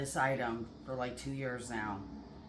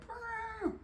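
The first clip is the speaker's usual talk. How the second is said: party horn noise; 5 dB quieter than usual. Retold or imitated. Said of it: "I wish I had a horn blower to go".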